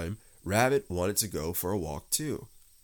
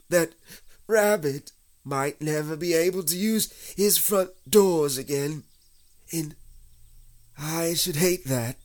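high, louder, plaintive bear voice; slightly sniffly; 10 semitones higher, 7 dB louder than usual. A narrator's reading. Stuck Pooh confides in Christopher Robin, slightly tearful. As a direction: fret plaintively in a raised pitch. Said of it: "sniffing slightly".

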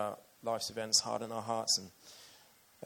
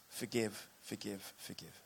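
fading repetition; 9 dB quieter than usual. A narrator's reading. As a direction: let the three words fade to almost nothing. Repeated word fading away.

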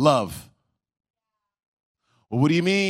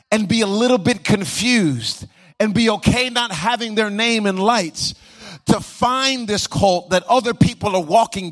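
preaching with rising fervour, a little higher; building preaching; 5 semitones higher, 4 dB louder than usual. Acted. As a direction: lift the sermon toward its climax.

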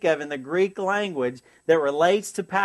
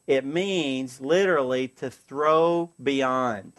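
"literally" leaned on, each word slow; slow, savoring definition; slow and deliberate; 5 semitones lower, much slower than usual. Shown as a demonstration. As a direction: spell out "throw beyond" slowly. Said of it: "I love that word".

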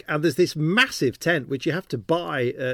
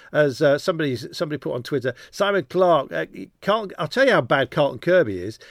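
usual speaking voice

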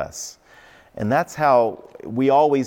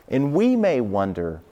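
ordinary talk, nothing marked

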